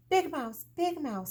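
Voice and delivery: high, loud call across the dark room; calling out to wake Big Mouse. A narrator's reading. Little Mouse calls to Big Mouse in the night, loud high call. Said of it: "called Little Mouse".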